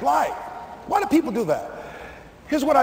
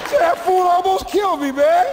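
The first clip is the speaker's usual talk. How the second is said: mock whine; 8 semitones higher than usual. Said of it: "I was crying".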